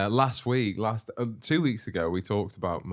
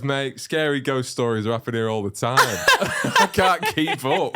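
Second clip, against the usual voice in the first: louder, laughing through the words; laughing brother; 5 semitones higher, 8 dB louder than usual. Retold or imitated. Imitating the brother on the phone, laughing his way through it. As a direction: laugh it off like it's all normal. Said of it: "And he goes".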